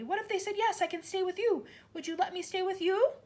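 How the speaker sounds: put-on high eager little girl; light up with hope; eager child. A narrator's reading. Matilda voiced.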